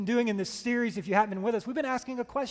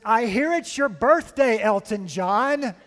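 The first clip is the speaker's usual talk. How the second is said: mock nervous fan, loud and drawn out; nervous, too loud; 7 dB louder, slower than usual. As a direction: let the volume run away with you, stretching the name. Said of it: "the volume of her voice starts to grow".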